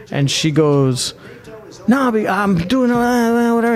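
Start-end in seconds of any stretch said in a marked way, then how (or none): 1.9-3.7 argumentative. Retold or imitated. Stewardess brushing off a passenger's complaint, mock argumentative. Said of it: "she's already arguing with him".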